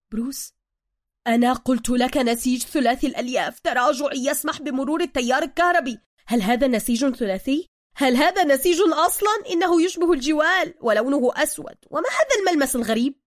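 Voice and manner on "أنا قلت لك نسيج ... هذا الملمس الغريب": loud, high outraged questioning; angry scolding. Acted. Bruce berating Suka over the fabric, angrily loud. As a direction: berate in indignant formal Arabic.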